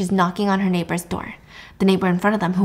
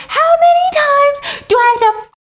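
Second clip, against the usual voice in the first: very high, loud shriek; hammed-up hysterical scream; 5 dB louder, slower than usual. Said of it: "She's screaming".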